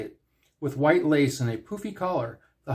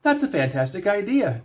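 high and smiling; delighted, with a smile in the voice; smiling reply; 7 semitones higher than usual. A narrator's reading. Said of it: "replied with a smile".

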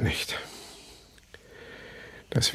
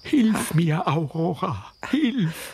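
much higher, strained cry for help; desperate cry; 10 semitones higher, slower than usual. Acted. Old man grabbed by the hair crying to his dead wife, desperate cry. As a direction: call out in panic as he is overpowered.

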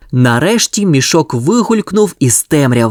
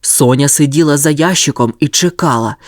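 ordinary delivery, nothing marked

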